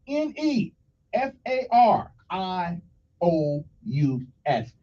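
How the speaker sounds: letters N E F A R I O U S spelled out slowly; slow spelling. Shown as a demonstration. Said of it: "listen closely".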